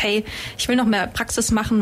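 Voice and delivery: normal talking voice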